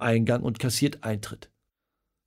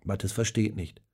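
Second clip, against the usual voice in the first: plain reading voice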